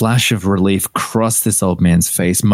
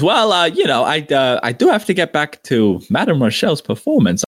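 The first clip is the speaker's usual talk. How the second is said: Bacchus voice; 6 semitones higher than usual. Acted. GM voices Bacchus, quoted after "he says.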